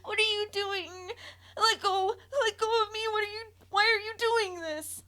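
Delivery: high, loud, terrified crying; child screaming in pain at her mother. A narrator's reading. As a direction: scream for her to stop, sobbing. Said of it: "as I cried".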